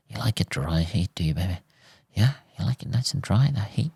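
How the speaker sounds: hammed-up seductive ASMR voice; mock ASMR seduction. To the viewers, parodying porn ASMR, a soft mock-seductive murmur. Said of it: "Like I'm doing porn A M, A S M R".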